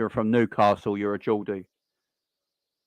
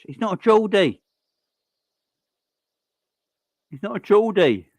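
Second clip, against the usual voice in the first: higher, louder, insisting; emphatic correction; 8 semitones higher, 6 dB louder than usual. Real, not acted. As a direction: set the record straight loudly. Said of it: "correcting a chat comment".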